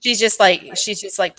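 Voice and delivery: normal talking voice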